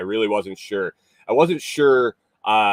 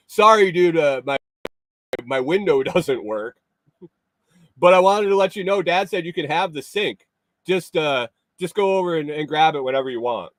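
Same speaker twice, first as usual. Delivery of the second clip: quoting the guy; 7 semitones higher than usual. Retold or imitated. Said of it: "he's like".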